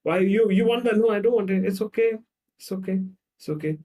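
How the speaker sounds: high and loud, then easing off; protest, then back down to keep peace; flustered placating. Acted. Acted row, child backing down.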